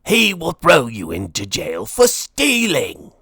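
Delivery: accusing villain outburst; threatening shout. A narrator's reading. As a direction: hurl the threat like a storybook bully. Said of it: "shouted Lord Gurr".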